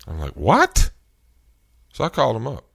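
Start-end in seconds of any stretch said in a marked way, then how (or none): 0.4-0.9 high, loud disbelieving what; startled loud what; shocked what. Retold or imitated. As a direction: blurt it out in total disbelief. Reacting to the company's text.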